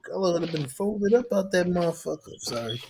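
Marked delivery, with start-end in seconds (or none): none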